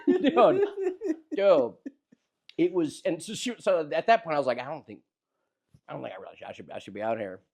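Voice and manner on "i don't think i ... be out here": low, soft muttering to himself; muttered doubt. Retold or imitated. Comedian quietly admitting he shouldn't be there, low muttering. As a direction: mumble it under your breath.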